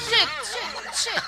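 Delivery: squeaky praising a dog. Acted. Ordering child like a dog.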